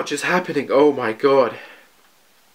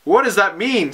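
ordinary talk, nothing marked

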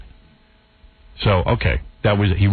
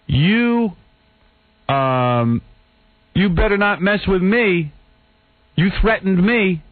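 higher, slowed, mock outraged accusation; lightly acted whiny indignation; indignant photographer; 7 semitones higher, much slower than usual. Photographer accusing the host, slow mock indignation. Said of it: "He goes".

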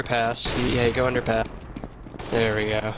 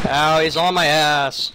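loud yelp; 4 semitones higher, 6 dB louder than usual. Real, not acted. Taking damage in game.

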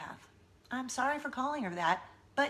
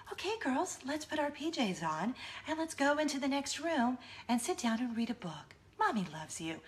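high, lilting, sweetly controlled mom voice; maniacal good witch; 4 semitones higher, 4 dB quieter than usual. Retold or imitated. Mom steering girls to pajamas and a book, maniacally sweet. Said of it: "crazy, maniacal, good witch voice".

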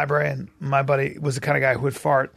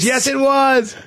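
teasing; 10 semitones higher, 5 dB louder, much slower than usual. Retold or imitated. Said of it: "He goes".

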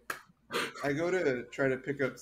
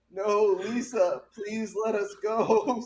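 mock pleading hostage; 8 semitones higher, 4 dB louder, slower than usual. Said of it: "by saying".

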